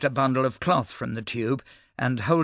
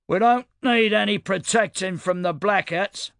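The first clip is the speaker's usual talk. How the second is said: high, louder call from the back rows; a voice yelling up from the back; shouted from the back; 8 semitones higher, 4 dB louder than usual. A narrator's reading. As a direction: shout it over the crowd. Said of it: "someone in the back shouted".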